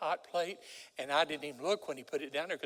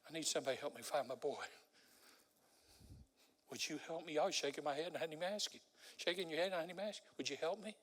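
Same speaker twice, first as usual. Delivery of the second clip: pleading; 7 dB quieter, faster than usual. Pretend. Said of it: "came down the aisles of this church and said".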